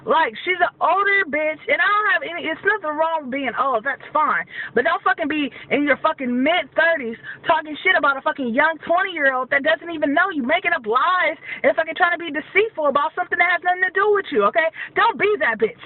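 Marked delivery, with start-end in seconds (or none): none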